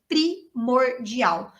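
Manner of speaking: "Primordial" broken slowly into pri-mor-dial; emphatic syllables. Real, not acted. Teacher underlining pronunciation's importance to students, emphatic syllable-by-syllable "Primordial". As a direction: stretch "Primordial", one deliberate syllable at a time.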